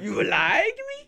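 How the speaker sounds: very high, loud, laughing squeal; squealing disbelief. Retold or imitated. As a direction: squeal it, flattered and giddy. Mocking own disbelief.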